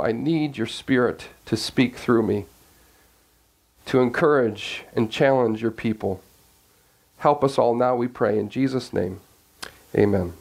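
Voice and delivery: low, unhurried prayer voice. Real, not acted. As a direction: reverent opening prayer. Opening prayer before sermon.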